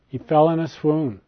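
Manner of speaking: usual speaking voice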